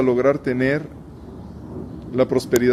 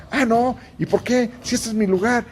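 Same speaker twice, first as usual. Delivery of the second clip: much higher, offended protest; indignant past self; 8 semitones higher than usual. Retold or imitated. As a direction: mimic your old self claiming your spot. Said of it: "hubo momentos en los que". (imitación aguda y ofendida de sí mismo)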